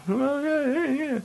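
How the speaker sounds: stalling while pretending to be the tutor; awkward filler. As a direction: trail off nervously, up high and quiet.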